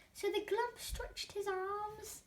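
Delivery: usual speaking voice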